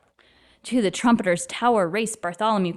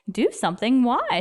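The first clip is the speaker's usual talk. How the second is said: ordinary delivery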